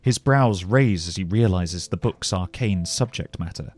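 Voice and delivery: plain reading voice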